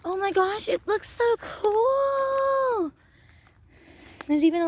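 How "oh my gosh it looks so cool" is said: awed. Pretend. Marvelling at the pretend spot.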